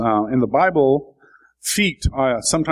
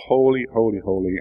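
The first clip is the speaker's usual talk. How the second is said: dull reading. Shown as a demonstration. Preacher showing how the verse reads on paper, dull. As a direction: read it off dully, trailing down. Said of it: "it sounds pretty dull, right?".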